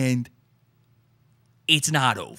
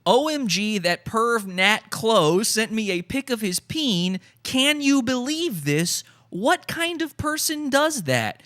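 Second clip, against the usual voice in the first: mock outraged fan; 6 semitones higher, slower than usual. Reading an outraged super chat.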